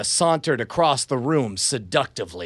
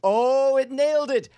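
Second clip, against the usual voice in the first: delighted surprise; 10 semitones higher than usual. Real, not acted. App transcribed correctly.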